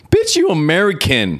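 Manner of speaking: high-pitched mock outrage; indignant retort. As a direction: call them out, incredulous. Acted retort to mourners online.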